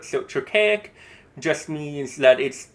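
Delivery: normal talking voice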